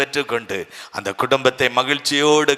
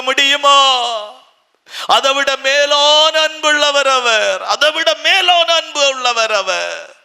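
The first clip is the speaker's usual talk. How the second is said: full-force preaching; 8 semitones higher than usual. Acted. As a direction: preach it big to the hall. Preaching God's love at full force.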